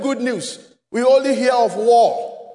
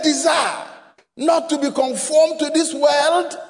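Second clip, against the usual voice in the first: rising shouted preacher's questions; preaching at full force; 2 semitones higher than usual. Real, not acted. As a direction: drive each question home.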